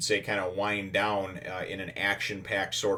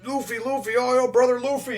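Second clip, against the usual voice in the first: higher, thinner cheering voices calling out; excited crewmates calling; 6 dB louder than usual. Retold or imitated. Crewmates cheer for Luffy, excited high calling. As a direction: hail your captain with glee. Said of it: "he's just like".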